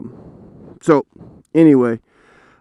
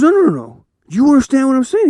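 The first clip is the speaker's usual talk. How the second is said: higher, faster pressing voice; insistent dad; 12 semitones higher, 4 dB louder, faster than usual. Retold or imitated. Dad pressing his son to understand, quick insistent voice. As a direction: push the point, quick and urgent.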